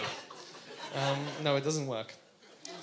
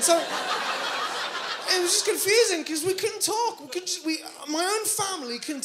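exasperated drawl; 9 semitones higher, 4 dB louder, slower than usual. Real, not acted. Exasperated about confusing family.

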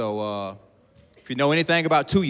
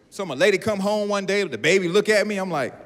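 ordinary talk, nothing marked

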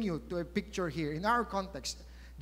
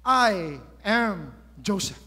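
louder, slow declaration; emotional reveal; 7 dB louder, slower than usual. Retold or imitated. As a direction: announce yourself, slow and loud. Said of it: "he said to his brothers".

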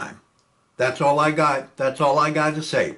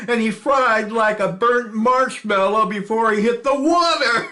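high, gleeful, laughing through the boast; giddy gunner crowing over his kill; laughing excitedly; 9 semitones higher, 4 dB louder than usual. Acted. Gunner boasting to Chelsea about the downed Zero, laughing. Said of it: "bursts in laughing".

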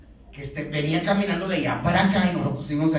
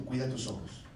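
quiet warning; 6 semitones lower, 12 dB quieter than usual. Real, not acted. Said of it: "Esto no lo tengo escrito aquí".

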